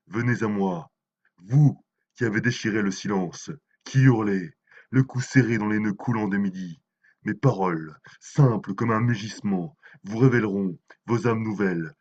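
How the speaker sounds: loud, rousing poetic summons; swelling, full-voiced oratory; impassioned declamation. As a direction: declaim it forcefully to a crowd. Poet summons the crowd in recital.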